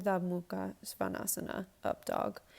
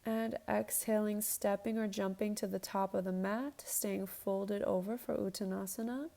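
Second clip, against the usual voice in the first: yoga instructor cueing. Acted. Leads a yoga flow.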